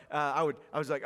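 normal talking voice

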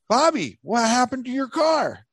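high, exaggerated imitation of a nosy friend; facetious mock Dan. Storyteller mimicking his friend asking about the car, facetious. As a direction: call it out in a hammed-up high voice. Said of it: "very, like, facetious or something".

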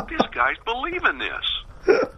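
high, slow, retold amazement; mock disbelief. Prankster realizing the writer believes him, mock disbelief. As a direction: draw it out high as your astonished inner voice.

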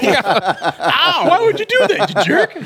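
high laughing outraged yelp; mock pain. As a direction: yelp like you got shoved. Character shoved into snowbank.

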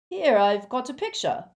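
high, loud eager shout. A narrator's reading. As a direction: shout to fill the silence. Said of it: "Lupin shouted".

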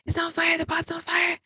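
high, frantic mock scream without the volume; retold panicked scream. Telling of calling husband about the pot fire, mock screaming. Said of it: "And I'm screaming".

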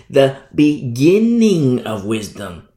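BEGINNING stretched out and stressed; emphatic. Shown as a demonstration. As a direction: lean hard on the word beginning, syllable by syllable. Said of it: "I have to emphasize".

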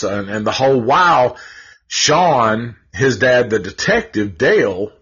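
ordinary delivery

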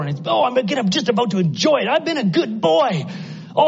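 high, loud protest, building as it goes; worked-up, climbing pulpit portrayal of a man torn; anguished rich young ruler. Acted. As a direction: plead desperately to keep what you have. Acts the ruler's inner battle.

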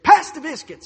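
sudden high, loud dinner-table holler; mock family shout. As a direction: bellow it across the table for a laugh. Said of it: "That was the only time we raised voices".

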